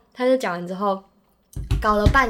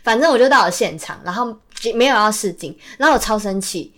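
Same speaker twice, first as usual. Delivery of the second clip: usual speaking voice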